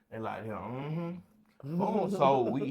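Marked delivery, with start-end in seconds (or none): 0.5-1.8 mummy-like murmur. Retold or imitated. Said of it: "Like a mummy said".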